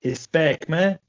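mock Brazilian accent, punched out; playful Brazilian-accented catchphrase. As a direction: imitate a Brazilian, R said as H. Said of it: "the way Brazilians say that shit".

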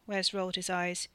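plain reading voice

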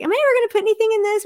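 high, rapid self-mocking quote; quick, high-pitched acted-out wondering; mock self-questioning. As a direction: rattle it off high in the voice. Acts out her own baby-book thought.

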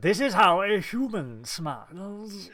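slow, laughing put-on voice; mock Spock. Host plays Spock faking human behaviour, slow mock voice.

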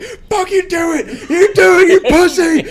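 high, screamed taunt, imitating a furious dad; hammed-up enraged scream; raging dad. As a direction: dare them at the top of your voice. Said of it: "five-foot-eight rage machine".

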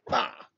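loud explosive "Bah!" like a domino slammed down; mock domino-slam shout; slam sound effect. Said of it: "the sound effect that I wanna give is".

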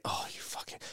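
whispered swearing; muttered curse. Retold or imitated. Husband as wife finally appears, muttered curse. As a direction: curse under your breath.

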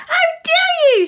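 very high, loud squeal; squeaked outrage. A narrator's reading. Defeated bully confronting the boy, high outraged squeak. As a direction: squeak it out, indignant. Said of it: "she squeaked out".

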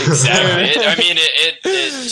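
words broken up by laughter; laughing while talking. Real, not acted. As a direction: talk through real laughter. Laughing through his words.

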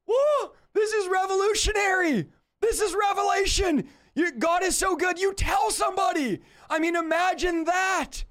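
excited shout. Real, not acted. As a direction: burst out with joy over God. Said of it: "I'm screaming".

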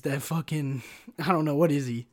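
ordinary delivery

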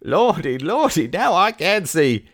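high, loud rustic exclamation; exuberant, stretched-out crowing; delighted cry. A narrator's reading. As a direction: cry out as if miraculously cured. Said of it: "cried Barnstable".

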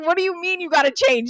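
high, loud mock disbelief; retold incredulity. JP questioning the sudden outfit change, imitated incredulously. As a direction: question it as a baffled friend would. Retelling JP's baffled question.

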